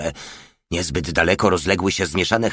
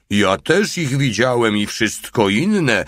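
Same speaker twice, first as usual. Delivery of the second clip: higher, slow, drawn-out call; 5 semitones higher, 4 dB louder than usual. A narrator's reading. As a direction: call it from the corner. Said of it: "zawołał z kąta mały".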